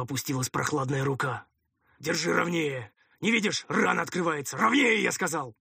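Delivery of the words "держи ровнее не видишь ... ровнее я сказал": higher, raised impatient orders; sharp, urgent ordering voice; irritated commander. Acted. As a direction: scold a helper, building to a loud demand. Commander orders helper over wound.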